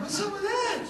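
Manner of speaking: startled yelp of disbelief; surprised retort. Retold or imitated. Preacher retelling his shock at Lake's words, squeaky and incredulous. Shocked by old man's remark.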